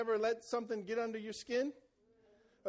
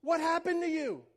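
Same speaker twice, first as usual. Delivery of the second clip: higher, stunned disbelief, half-shouted; shocked exclaim; 7 semitones higher, 4 dB louder than usual. Retold or imitated. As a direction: cry out as if you can't believe it. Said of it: "He's like".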